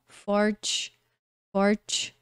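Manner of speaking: "forte" said as English "forch"; TCH sound. Shown as a demonstration. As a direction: end "forte" on a clear tch. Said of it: "como se fosse um T C H". ("forte" terminando em som de tch)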